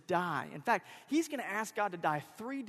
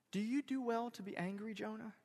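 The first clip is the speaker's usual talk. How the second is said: gentle, quiet question lifted in pitch; soft question; 3 semitones higher, 7 dB quieter than usual. Acted. God gently challenging Jonah's tantrum, soft. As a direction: ask it in one soft flow, fading on "Jonah". God's question repeated more softly.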